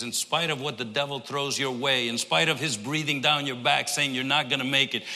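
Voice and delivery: normal talking voice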